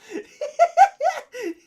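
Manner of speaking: a wild tipsy cackle. Real, not acted. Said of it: "he's doing the drunk laugh".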